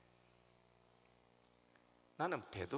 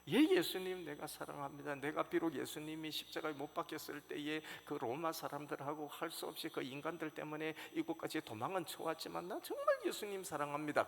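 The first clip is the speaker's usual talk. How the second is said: high, loud, rushing declaration of love; eager vow; 6 semitones higher, 5 dB louder than usual. Retold or imitated. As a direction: blurt it out, desperate to prove himself. Peter's eager vow to Jesus.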